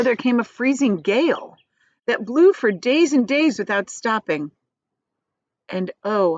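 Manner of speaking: plain reading voice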